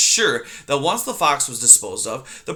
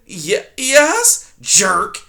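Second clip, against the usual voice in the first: scornful; 7 semitones higher, 6 dB louder, much slower than usual. Acted. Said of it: "Erith said scornfully".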